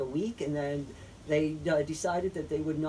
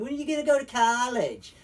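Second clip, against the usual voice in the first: nagging parent; 6 semitones higher, 6 dB louder than usual. Retold or imitated. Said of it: "Parents are like".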